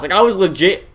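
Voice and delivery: plain reading voice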